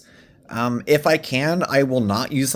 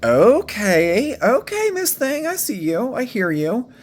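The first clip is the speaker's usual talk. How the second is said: much higher, sassy "Miss Thing" voice; sassy guy impression; 9 semitones higher, slower than usual. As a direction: play a guy playfully hyping her up. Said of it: "was like".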